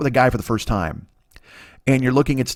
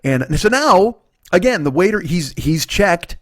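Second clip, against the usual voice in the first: usual speaking voice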